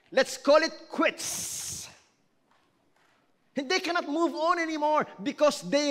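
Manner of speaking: loud, driving sermon voice; fiery preaching. Acted. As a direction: preach it hard to the congregation. Pastor preaching at full force.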